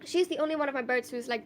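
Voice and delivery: normal talking voice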